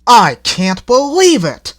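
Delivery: high, loud, slow exasperated engine; can't believe his coaches; with great feeling. A narrator's reading. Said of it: "said Toby with great feeling".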